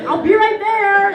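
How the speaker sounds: cheerful reply to her husband; nanny answering. Retold or imitated. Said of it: "she's like".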